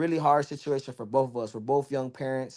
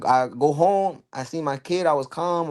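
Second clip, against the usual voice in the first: usual speaking voice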